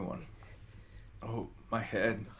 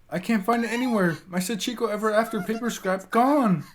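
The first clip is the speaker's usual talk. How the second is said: higher and louder, panicked searching; frantic outcry; 11 semitones higher, 9 dB louder than usual. Acted. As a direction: yell in distress. Frantic over lost charm.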